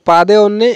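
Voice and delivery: পাদে অন্যে with the first n missing; faulty পাদে অন্যে, demonstrated; wrong pronunciation. Shown as a demonstration. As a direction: say পাদে অন্যে as two words, de for din. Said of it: "এটা কিন্তু সঠিক না".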